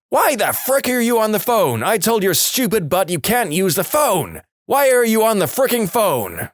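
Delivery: high, angry retold yell from an outraged mother; mother bursting through the door and yelling at her teen son; furious yelling mum. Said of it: "Loud as any person can be".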